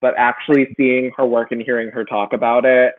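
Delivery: usual speaking voice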